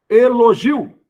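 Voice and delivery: "Elogio" high and loud, final IO in one syllable; syllables sounded out. Shown as a demonstration. Teacher showing a diphthong, word sounded out loudly. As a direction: announce "Elogio" loudly, gliding the last vowels together. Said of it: "Na mesma sílaba. É um ditongo".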